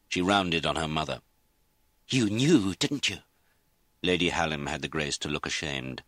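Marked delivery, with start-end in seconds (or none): 2.1-3.2 higher, forceful accusation; cold rage. A narrator's reading. Daughter rounds on her mother, cold rage. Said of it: "Lizzie felt frozen with rage".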